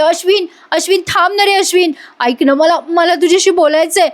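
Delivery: mock desperate appeal to wait; acted pleading call. Priya begs Ashwin on the stairs to listen, retold pleading.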